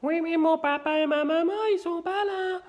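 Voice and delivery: put-on high childish voice fooling a salesman; kid's voice. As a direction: pretend to be a small kid. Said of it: "tu prends la voix d'un gamin".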